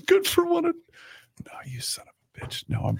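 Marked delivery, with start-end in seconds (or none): 1.5-2.6 unvoiced mock insult whisper. Real, not acted. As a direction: whisper it under breath. Whispered aside.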